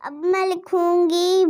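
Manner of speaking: normal talking voice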